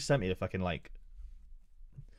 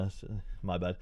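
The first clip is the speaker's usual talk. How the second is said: ordinary talk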